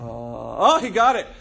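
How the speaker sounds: normal talking voice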